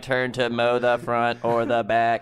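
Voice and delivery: slow, dopey robot voice; dumb robot voice. Pretend. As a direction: ask it like a confused robot. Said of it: "so that's what he does".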